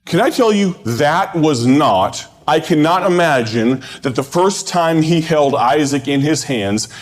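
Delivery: high-pitched, projected sermon delivery with long pauses; preaching. Real, not acted. Preacher urging campers to let go of their plans, preaching. Preaches a recorded camp message.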